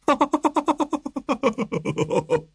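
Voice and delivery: high roguish cackling laugh; mischievous cackle. Acted. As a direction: cackle like an amused bully. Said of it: "verfiel der Hüne in ein schelmisches Kichern".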